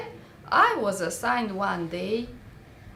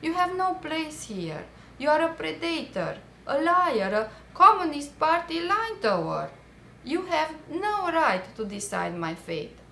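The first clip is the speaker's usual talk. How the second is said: higher, mild inner outburst; imagined scream; 7 semitones higher than usual. Retold or imitated. At the movie star on the commission, a mild imagined outburst. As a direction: hurl the accusations in your head. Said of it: "I wanted to scream at him".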